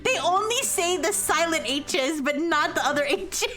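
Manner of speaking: laughing. Real, not acted. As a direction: talk through rising giggles. Cracking up over silent H's.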